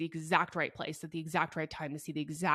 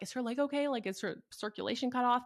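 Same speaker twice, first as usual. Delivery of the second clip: mock worried stranger; 6 semitones higher than usual. Strangers asking worried questions.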